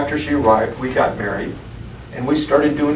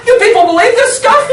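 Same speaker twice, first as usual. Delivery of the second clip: much higher, laughing through the words; laughing disbelief; 10 dB louder than usual. Retold or imitated. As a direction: squeal it out in amused disbelief. Wife's disbelief, teller laughing.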